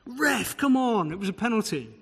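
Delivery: high-pitched appeal to the referee; mock fan protest. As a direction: play the aggrieved supporter pleading a case. Acted sports fan appealing to referee.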